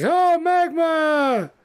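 very high, drawn-out squeal of joy; gleeful greeting. Acted. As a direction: halflings squeal her name, thrilled. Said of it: "they all look at you like".

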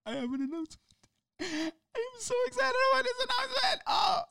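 sudden high squeal of excitement; voice jumps way up. Real, not acted. Host to listeners, squealing the announcement up high. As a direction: pitch shoots up, barely contained. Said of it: "my voice went up into that, that range".

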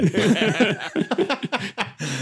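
cracking up; high burst of laughter. Real, not acted. Laughs at card joke.

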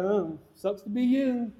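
slow, soft, drawn-out taunt; imitated dismissive jeer, lazily stretched; mock gloating. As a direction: draw it out with pretend pity. Acts out gloating while driving past.

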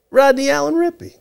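put-on high-pitched child giving his name; little-kid answer. Small boy answers the casting man, high child voice. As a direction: imitate your three-year-old self, high.

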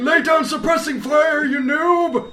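high, loud, slow battlefield shout; cover me shout. Pretend. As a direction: yell commands at a useless teammate. Old gamer barking orders in-game.

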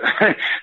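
breathy high laugh; quick airy chuckle; a brief unvoiced laugh; short laugh. Real, not acted. Laughs at the story's turn.

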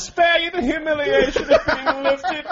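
stuffy gentleman sparing you shame; turn of the century gent. Acted. Co-host mocks the refusal as a pompous old-time gentleman. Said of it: "doing it as good as Kyle".

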